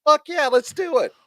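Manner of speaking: high-pitched eager yes; gung-ho acted reply. Agreeing to a friend's movie marathon, hammed-up eagerness.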